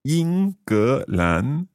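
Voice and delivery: very slow, syllable by syllable; slow learner's repeat. Shown as a demonstration. Learner repeating the teacher's Chinese phrase, very slowly. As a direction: draw out each tone carefully. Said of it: "saying each of these phrases quite slowly".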